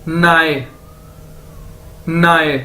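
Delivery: नाए as one long gliding syllable; pronunciation demo. Shown as a demonstration. Teacher shows how NAI is read, pronunciation demo. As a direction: model नाए held out and slightly falling. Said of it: "हमारे पास एनएआई".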